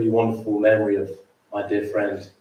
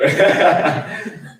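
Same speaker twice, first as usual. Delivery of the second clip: laugh; 7 semitones higher, 4 dB louder than usual. Real, not acted. Laughing at his own joke.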